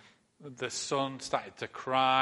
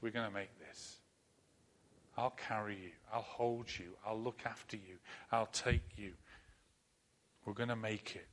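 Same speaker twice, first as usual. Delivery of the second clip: tender comfort; 2 semitones lower, 7 dB quieter than usual. Pretend. God comforting a frightened listener, soft and tender. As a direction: hold them close with the voice.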